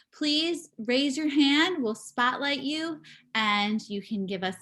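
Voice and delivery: usual speaking voice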